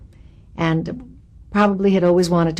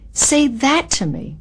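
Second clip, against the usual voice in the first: normal talking voice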